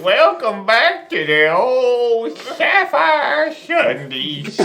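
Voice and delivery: put-on slow high drunk drawl, half laughing; town drunk. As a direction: stretch it out like a sloshed regular. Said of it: "there's one guy in the corner like".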